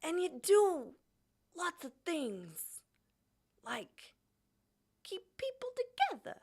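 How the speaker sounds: lightly voiced halting Walken; Christopher Walken impression. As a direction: pause oddly between phrases, hint of Walken. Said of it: "What does my Christopher Walken sound like?".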